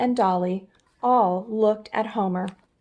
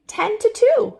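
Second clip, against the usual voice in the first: high and louder; high piping answer; 13 semitones higher, 6 dB louder than usual. Acted. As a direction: chirp it brightly. Homer answering chipmunk.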